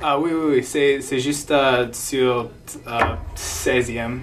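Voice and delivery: English-speaker accent in French; careful learner French with English accent; foreign accent in French. Real, not acted.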